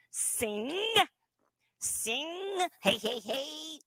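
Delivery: little nasal ventriloquist voice, lips still. Shown as a demonstration. Teacher demoing the nasal voice for students. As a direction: say it through the nose without moving lips. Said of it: "we're gonna use that little nasal voice".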